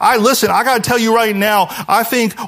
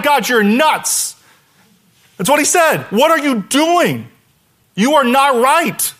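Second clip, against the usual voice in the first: slow, raised, exasperated complaint; complaining to God; 6 semitones higher, slower than usual. Retold or imitated. Habakkuk complaining to God, slow and exasperated. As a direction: drag out the outrage at God. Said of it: "Habakkuk literally said to God".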